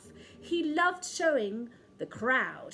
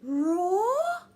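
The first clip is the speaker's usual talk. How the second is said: higher squeaky polite mouse; high timid little mouse; 7 semitones higher than usual. Acted. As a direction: nervously ask the giant lion. Timid mouse addresses lion.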